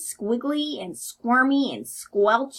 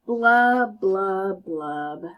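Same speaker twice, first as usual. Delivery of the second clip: sinking blubs; 4 semitones lower, much slower than usual. Acted. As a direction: let the blubs sink and die out. Fish's sad refrain.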